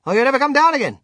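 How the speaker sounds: full-force, high-pitched shout. A narrator's reading. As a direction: yell it up at someone floating away. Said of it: "I stood there shouting".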